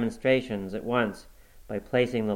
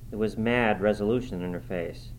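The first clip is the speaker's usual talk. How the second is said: usual speaking voice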